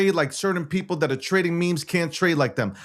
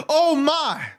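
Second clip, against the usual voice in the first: impressed mama; 6 semitones higher than usual. Retold or imitated. Mama meeting her daughter's boyfriend, mock impressed. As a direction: play her mother swooning at him. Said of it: "she would say".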